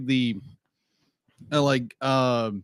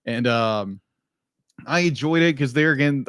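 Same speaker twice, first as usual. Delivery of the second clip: normal talking voice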